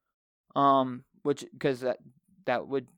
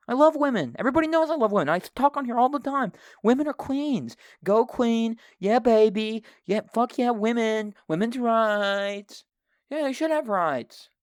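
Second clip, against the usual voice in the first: higher, louder hammed-up praise for women; mock cheerleading; 6 semitones higher, 3 dB louder than usual. Host overdoes praising women to dodge offense, mock cheering. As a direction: cheer like a fake fan.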